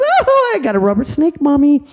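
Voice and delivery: high, squealing delight; thrilled little boy. Acted. Little boy showing Mommy his rubber snake, high and thrilled. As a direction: show off the prize to Mommy. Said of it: "He goes".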